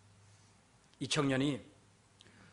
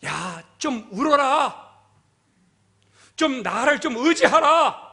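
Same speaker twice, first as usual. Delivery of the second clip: God's impassioned plea; 7 semitones higher, 6 dB louder than usual. Acted. God pleading with a hard-hearted people, loud preaching. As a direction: cry out a forceful rebuke as God. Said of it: "오늘 하나님은 우리들에게".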